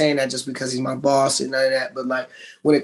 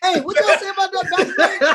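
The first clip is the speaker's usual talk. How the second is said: laughing ask. Retold or imitated. Retelling asking for back plate.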